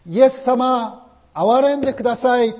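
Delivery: high, loud cry for mercy; a beggar's loud, pleading shout. Acted. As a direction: call out to Jesus, begging loudly. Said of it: "そう声を張り上げて叫び求めよう".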